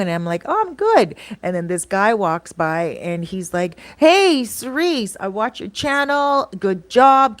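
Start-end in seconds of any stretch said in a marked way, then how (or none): 0.5-1.2 chirpy high-pitched "I'm good"; sugary high greeting; sweet high reply. Retold or imitated. Narrator's sweet reply to the woman. 4.0-7.4 slow, high put-on voice of a friendly guy; hammed-up high fan voice; imitating a passing fan. Passing guy praises her channel.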